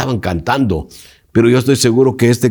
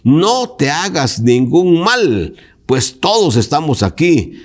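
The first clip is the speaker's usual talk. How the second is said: a touch of a cry, slow and pitched higher; mild call out; 7 semitones higher, slower than usual. A narrator's reading. Paul calls to the jailer, a mild slow cry. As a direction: call out slowly, not full force. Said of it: "gritó a gran voz".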